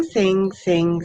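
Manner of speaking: ordinary delivery, nothing marked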